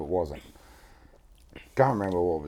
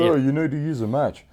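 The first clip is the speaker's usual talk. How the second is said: mock snooty advice; 7 semitones higher than usual. Mimicking snobby cigar guy.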